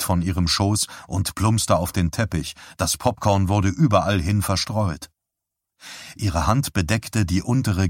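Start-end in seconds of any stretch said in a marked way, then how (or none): none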